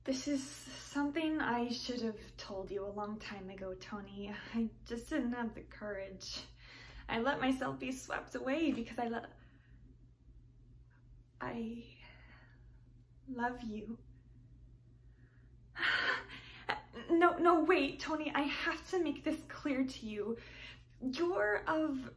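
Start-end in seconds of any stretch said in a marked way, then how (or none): 0.0-14.0 hushed, whispered, halting confession; tragic heroine sorrow. Acted. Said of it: "You Can't Take It With You". 17.1-18.3 urgent plea. Acted. Pleading lover stops Tony.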